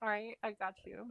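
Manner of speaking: normal talking voice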